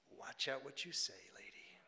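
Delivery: very soft, half-whispered warning; muttered inner thought. Retold or imitated. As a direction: murmur it under your breath. Retells his private warning thought.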